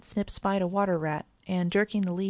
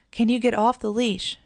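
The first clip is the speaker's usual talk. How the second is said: loud call across the water; shout; 4 semitones higher, 6 dB louder than usual. A narrator's reading. Brother shouts to the boy in the pond, loud. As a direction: shout out to the swimmer. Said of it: "shouted Bob".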